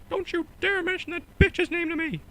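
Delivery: very high falsetto mouse shout; imitated mouse outburst, loud and high; Mickey Mouse, furious. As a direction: explode in a squeaky cartoon voice. Mickey snaps back at Goofy.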